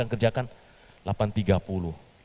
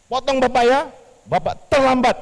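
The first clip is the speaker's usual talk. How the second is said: much higher, louder reprimand; scolding boss; 10 dB louder than usual. Acted. The boss scolding Petrus for being late, loud. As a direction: dock his pay sharply.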